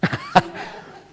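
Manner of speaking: squealing gossip giggle. Acted. Mock gossip scene.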